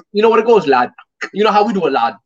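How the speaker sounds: playful mimicry of a fighter's catchphrases; imitating a British fighter. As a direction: toss it out high, ending on "lad". Mimics the UK fighter's interview talk.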